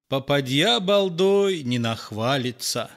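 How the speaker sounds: usual speaking voice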